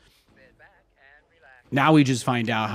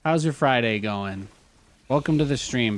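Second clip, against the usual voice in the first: normal talking voice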